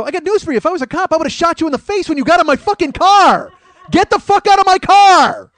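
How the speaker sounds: high, loud furious yell, slowing at the end; full-throated outraged shout; angry yell. Retold or imitated. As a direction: roar at her to get out, building to the last line. Yelling at woman in car.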